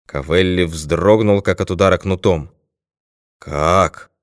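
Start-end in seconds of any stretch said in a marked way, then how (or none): 3.4-4.1 a touch of hoarse shock; hoarse question. A narrator's reading. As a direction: ask it hoarsely, taken aback. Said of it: "хрипло спросил он".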